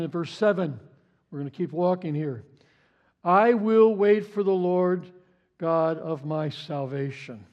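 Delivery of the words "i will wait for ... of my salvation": slow solemn reading. Acted. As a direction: stretch each word of the vow. Reading Micah's vow slowly.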